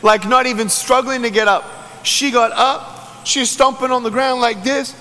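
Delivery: usual speaking voice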